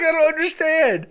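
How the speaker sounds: high laughing confusion; a hint of exasperated disbelief; mock bafflement. Host acting out her own confusion, high and laughing. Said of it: "I'm just like".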